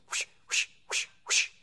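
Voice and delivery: soft breathy whooshing noises; voiced swish of rubber on wet glass; wiper whooshes. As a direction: mimic wipers swishing the windshield.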